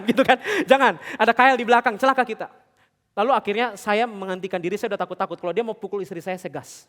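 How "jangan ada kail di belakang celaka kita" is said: raised, louder mock panic; retold alarmed warning. Husband warning his wife of trouble, mock panicky warning. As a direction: warn of danger as if retelling a scare.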